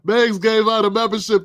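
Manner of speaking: usual speaking voice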